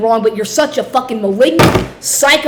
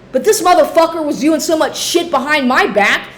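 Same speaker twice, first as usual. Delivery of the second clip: ordinary talk, nothing marked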